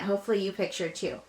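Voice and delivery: ordinary talk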